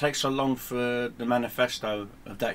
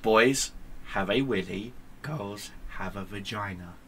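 usual speaking voice

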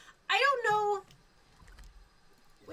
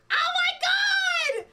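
very high, loud squeals; ecstatic; 11 semitones higher, 6 dB louder, much slower than usual. Real, not acted. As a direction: shriek with overwhelmed joy. Hype train keeps growing.

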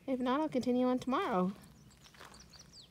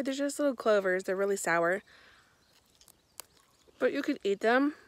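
usual speaking voice

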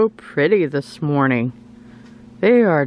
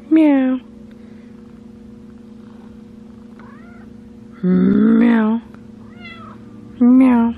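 cute mock cat meow; imitated meow; 8 semitones higher, much slower than usual. Meowing at the cat.

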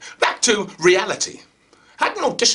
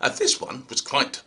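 ordinary talk, nothing marked

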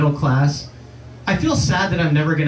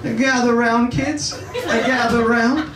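put-on gather the kids round like a doting grandpa; grandfather storytelling; 7 semitones higher than usual. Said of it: "can you picture me as a grandfather".

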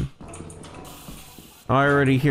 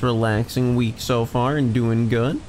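normal talking voice

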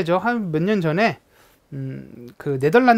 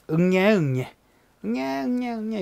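put-on baby cry demo; 5 semitones higher than usual. Shown as a demonstration. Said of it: "이렇게 운다고".

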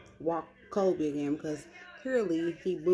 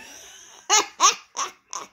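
high burst of laughter; real laughing fit; loud laugh; 12 dB louder than usual. Real, not acted. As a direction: laugh hard at the story. Laughing at sister's story.

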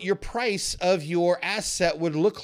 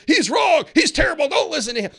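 high, loud jeering hater voice; mocking commenters; 9 semitones higher, 6 dB louder than usual. Imagined viewers attacking him in the comments, mock jeering. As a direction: ham up the angry critics. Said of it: "type into the chat".

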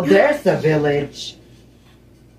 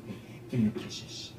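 breathy whisper, much softer; whispered coaxing; 7 semitones lower, 14 dB quieter than usual. Pretend. Gamer coaxing an in-game pet for kisses, whispered.

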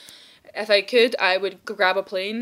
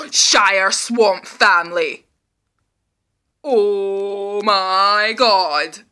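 slow, awed delight; 5 dB louder, much slower than usual. Real, not acted. Thrilled by own idea.

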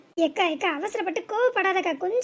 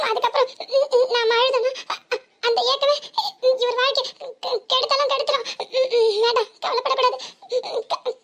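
choked sobs breaking through each phrase, voice raised; sobbing; 2 semitones higher than usual. Acted. A wife weeping over her husband's second marriage, sobbing. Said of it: "இப்படி ஏங்கி ஏங்கி அழுவுறா".

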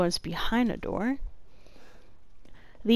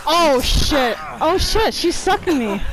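panicked; 5 semitones higher, 5 dB louder than usual. Real, not acted. Being fed on by the monster.

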